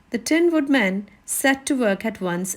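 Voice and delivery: usual speaking voice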